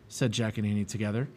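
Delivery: ordinary delivery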